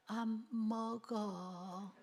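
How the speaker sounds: a slow, soft, half-hearted no; a hungry child barely refusing, very slowly; a drained, hungry child still says no; weak hungry refusal. Retold or imitated. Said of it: "그랬던 애가 이제는".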